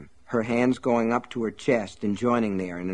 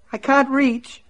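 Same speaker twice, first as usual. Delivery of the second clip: frightened plea; 4 dB louder than usual. Acted. Myrtle held by hair.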